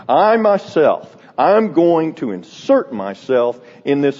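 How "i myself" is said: I said slow and high, stressed; emphatic I. Shown as a demonstration. Preacher stressing the pronoun I, emphatic. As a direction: lean hard on I. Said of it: "is very emphatic".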